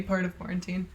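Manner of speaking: ordinary delivery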